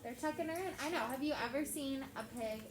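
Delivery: ordinary delivery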